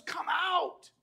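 high, drawn summoning call; summoning shout. Acted. Preacher voicing Jesus to some of us, summoning shout. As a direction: call out to bring them forth. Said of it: "he's saying to some of us".

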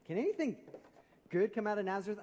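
high-pitched, emphatic skeptical question; incredulous. Retold or imitated. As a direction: throw the question out in open disbelief. Repeats Nathanael's question, now incredulous.

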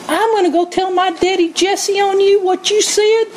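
a hint of a sulky little brother; tattling child. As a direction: threaten like a kid running home. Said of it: "David could have gone".

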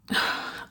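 resigned sigh; soft, voiceless sigh of giving in. A narrator's reading. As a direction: let out a quiet, resigned breath. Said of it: "Waverley sighed".

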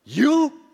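"You" thrown out high and loud; emphatic "You". Shown as a demonstration. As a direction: punch the word "You" hard. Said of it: "it's more emphatic".

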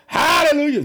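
high, loud hallelujah; real praise shout. Real, not acted. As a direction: cry out in joyful praise. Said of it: "I'm shouting".

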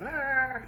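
high playful "Ah!" jump-scare; five-year-old jumping out to scare grandad, high mock yell. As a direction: leap out with a hammed-up high yell. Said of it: "a grand display of".

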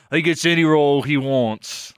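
put-on Cockney accent, slow; slow exaggerated Cockney voice. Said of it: "say it in a Cockney accent".

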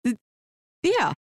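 ordinary talk

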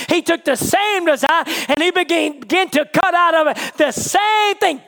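high, driving shouted sermon; full-force preaching. Real, not acted. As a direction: build to a ringing pulpit climax.

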